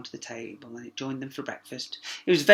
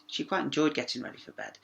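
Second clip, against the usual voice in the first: ordinary delivery, nothing marked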